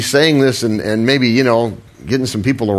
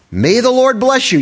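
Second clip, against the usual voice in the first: high, bright mock-cheery hypocrite greeting; cheerful greeting; 12 semitones higher than usual. Saul greeting the prophet with fake cheer. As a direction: greet him with fake sweetness. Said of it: "Saul greeted him cheerfully".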